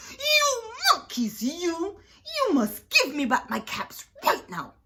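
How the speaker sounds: high, loud storybook outburst; angry shout. Acted. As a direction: shout it as a very angry peddler, drawing out the opening. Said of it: "He stomped both his feet and shouted".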